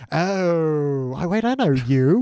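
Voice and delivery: long drawn-out opening, then recognition; mock realization. Woman recognizing the man she tries seducing, mock realization. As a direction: stretch the dawning discovery, lightly acted. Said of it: "She's like".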